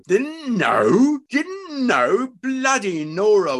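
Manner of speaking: high, loud, drawn-out scorn; incredulous fury. A narrator's reading. Husband rounding on his wife, incredulous fury. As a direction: throw the words back in outrage.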